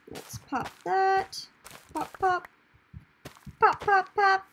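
high, slow sing-song pops; playful sing-song. Real, not acted. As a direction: chant it playfully. Playful popping while playing.

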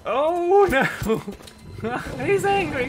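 high, loud yell breaking into laughter; laughing panic. Real, not acted. Player sees the attacked creature is angry, laughing panic. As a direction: wail in alarm, then laugh.

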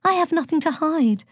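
high, airy goddess voice; high-pitched innocent lilt; breezy. A narrator's reading. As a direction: answer without a care. Aphrodite breezily answers inspector.

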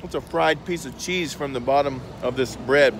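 chewing between words; talking while chewing. Real, not acted. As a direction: mouth partly full. Seen 0: Bites at -5 and +3.9; still chewing while talking, holding piece.

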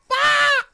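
high, loud shriek; panicked scream. Real, not acted. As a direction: scream out in alarm. Scream in game.

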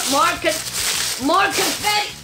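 high exasperated whine; mock despair. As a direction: groan in playful defeat.

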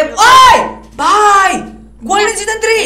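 high, loud excited shout. Real, not acted. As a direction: yell out in amazed excitement. Excited at a game item.

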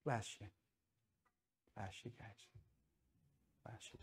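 fading to a whisper; a pastor gently blessing those who came forward, fading to a whisper; soft blessing. Real, not acted. Altar call, blessing respondents.

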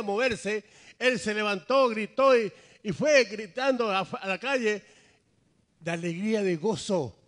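loud, high, full-force sermon delivery; impassioned preaching. Real, not acted. Preacher telling of the healed man leaping for joy, exultant. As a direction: proclaim it to the whole congregation.

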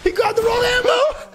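high, frantic shout; panicking gamer. Real, not acted. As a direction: yell it in sudden disbelief. Reacts to a fight going wrong.

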